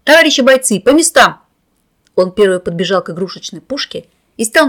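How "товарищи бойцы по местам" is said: loud, high battle order; commanding shout. A narrator's reading. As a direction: bark it like a commander. Boy commanding his playmates.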